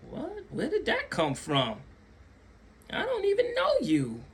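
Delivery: mock bafflement. His baffled reply retold.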